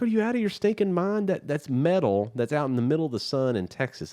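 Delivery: high-pitched, exasperated outburst; incredulous scolding. Acted. Moviegoer to climbers without gloves, incredulous scolding.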